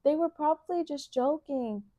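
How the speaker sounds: lightly voiced comforting friend; mock comforting. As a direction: soothe her gently. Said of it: "And I'm like".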